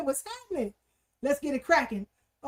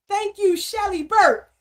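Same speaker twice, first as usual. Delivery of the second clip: loud call-out; 4 semitones higher, 7 dB louder than usual. Real, not acted. Host calls out a viewer's name.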